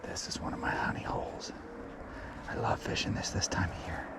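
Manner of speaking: soft secretive whisper. Real, not acted. Angler at his honey hole confiding to viewers, whispered. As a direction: let them in on a secret, barely voiced. Quiet at favorite fishing spot.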